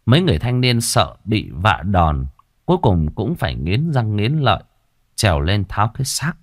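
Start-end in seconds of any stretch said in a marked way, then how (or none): none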